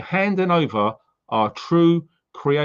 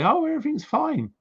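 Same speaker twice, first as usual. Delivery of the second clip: mock cheerful. Mocking people who fake being fine.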